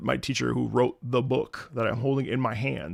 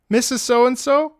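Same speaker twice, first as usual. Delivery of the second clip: lightly acted schoolkid calling on teacher, raised voice; innocent pupil question; 7 semitones higher, 5 dB louder than usual. Tenth grader asks English teacher before the class, mildly naive raised voice. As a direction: ask loudly and guilelessly, starting high.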